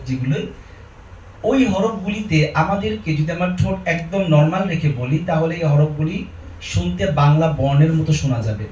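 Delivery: ordinary delivery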